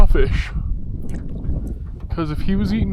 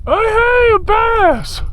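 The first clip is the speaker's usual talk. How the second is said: high excited call; thrilled cry on spotting a bass; excited shout; much slower than usual. Real, not acted. Catching a fish, calling out.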